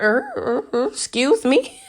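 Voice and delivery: stammered, squeaky high disbelief; a high, stuttering double take; shocked excuse me. Retold or imitated. Vlogger reacting to the secret son reveal, in squeaky stammering shock. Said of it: "that sound was quite accurate".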